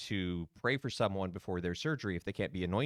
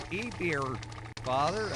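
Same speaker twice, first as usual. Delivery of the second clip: bad Midwestern accent; 6 semitones higher than usual. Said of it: "bad Midwestern accent".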